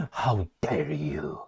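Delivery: low, slow, hushed mock outrage; mock Greta Thunberg. Mocking the French minister as Greta, slow and hushed. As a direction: drag out the words in mock indignation. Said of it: "Remember Greta?".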